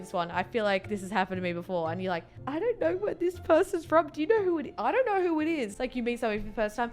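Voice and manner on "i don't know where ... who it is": high, puzzled inner voice; mock bewilderment. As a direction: imitate someone lost, pitched up throughout. Acts bewildered person meeting a stranger.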